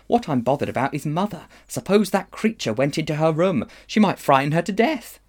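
rising, high anxious voice; worried boy. A narrator's reading. Diggory fears the witch will frighten Mother, anxious.

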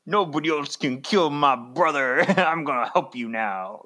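mock-heroic declaration with a laugh breaking in; Sabretooth impression. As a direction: play a comic-book brute coming to the rescue. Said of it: "suddenly Sabretooth's like".

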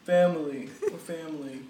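a light take on a tough action star; Vin Diesel. Retold or imitated. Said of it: "He's like".

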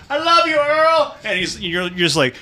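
ordinary talk

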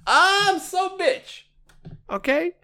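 high, slow sassy drawl; sassy diva. Pretend. Host mocking himself as sassy, high camp voice. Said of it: "sassy high aside".

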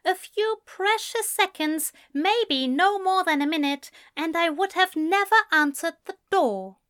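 high-pitched, emphatic argument; indignant Ezra. A narrator's reading. Man tells friend that night was chance, indignant. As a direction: press the point in a high voice.

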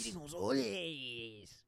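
stretched-out cartoon catchphrase; Speedy Gonzalez. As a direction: echo a cartoon mouse, dragging the name. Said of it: "Yeah, just like that".

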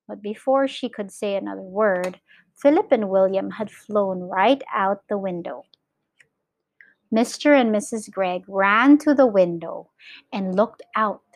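ordinary delivery, nothing marked

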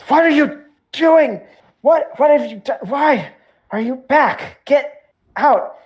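high, loud panicked yell; yelling priest. Acted. Priest yelling at the intruder to get out. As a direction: yell him back out the door. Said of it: "and yells at you".

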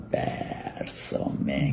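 barely breathed, frozen with fear; frightened whisper. Retold or imitated. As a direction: whisper it, not daring to move. Frozen facing three men with pistol. (ante la pistola, susurro asustado)

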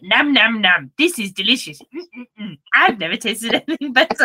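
smug puppy loving the food, laughing through it; greedy happy dog voice. Pretend. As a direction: voice the dog gobbling with delight. Dog voiced eating happily.

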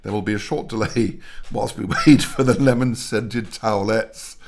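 mock announcement, breaking into laughter; laughing through it. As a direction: read the robot announcement while giggling.

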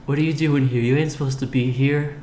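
lightly voiced distant stoner; spaced-out stoner voice. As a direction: drift off like a stoner. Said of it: "he has a very, like, distant voice".

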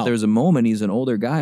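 ordinary talk

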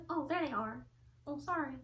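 soft imitated offhand realization; sheepish understated apology. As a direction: drop to a small, offhand voice. Grandma sheepishly finding her cigarettes.

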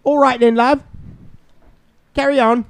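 cheery put-on British send-off. Co-host telling partner to carry on, put-on British.

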